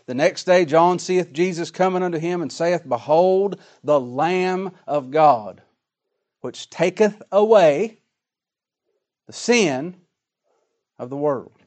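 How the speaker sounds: slow, swelling pulpit reading; preaching scripture reading. Acted. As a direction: proclaim it like a preacher. Reads scripture aloud with rising force.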